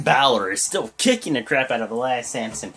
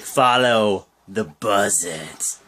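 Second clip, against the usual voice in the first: creepy Bray Wyatt; 8 semitones lower, much slower than usual. Retold or imitated. Bray Wyatt taunting after the match, creepy slow voice. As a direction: lower and very slow, eerie. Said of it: "he's like".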